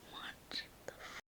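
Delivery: barely audible slow whisper; hushed, voiceless bewilderment; whispered disbelief. Retold or imitated. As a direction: whisper a baffled curse. Mouthed confused reaction in story.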